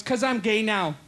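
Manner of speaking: put-on camp voice, sinking soft and low. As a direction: confide it in a mock sultry tone. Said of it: "Why are you talking like that?".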